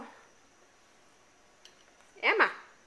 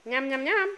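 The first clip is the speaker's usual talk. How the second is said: put-on playful chewing sounds for baby; nom nom chomping; 4 dB louder, faster than usual. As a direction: chomp it out to coax a bite. Modelling eating for baby.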